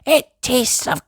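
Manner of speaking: high, slow disgusted sheep voice; high yucky little sheep; Susie Sheep disgusted. Acted. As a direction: whine about the taste. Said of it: "says Susie".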